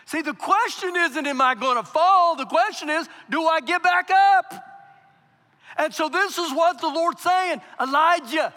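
loud, high sermon climax; fiery preacher's call; preaching at full force. Acted. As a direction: drive it home to the church.

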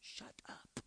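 the Lord's rebuke, whispered gently; soft whispered hush from the Lord; loving whisper. Retold or imitated. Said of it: "the Lord will speak to me this way".

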